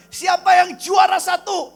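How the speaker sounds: loud, high, drawn-out call; grandmother's announcement. Retold or imitated. Grandma calling her grandchildren for prize money, shouting. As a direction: shout it out slowly to the whole group. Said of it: "emak akan bilang".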